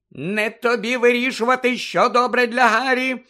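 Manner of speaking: high, loud angry rebuke; scolding protest, pitched high; sharp interruption. A narrator's reading. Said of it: "урвала його місіс Візлі".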